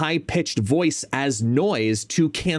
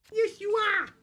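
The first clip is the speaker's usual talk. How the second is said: squeaky pet talk; 5 dB quieter than usual. Real, not acted. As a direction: squeal it adoringly to a dog. Baby talk to the dog.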